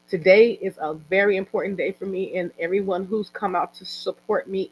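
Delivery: usual speaking voice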